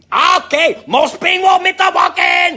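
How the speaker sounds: the Chinese restaurant waitress greeting Walken, high and loud; imitating the waitress. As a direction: belt it out high like the excited lunch lady. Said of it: "And he'd go".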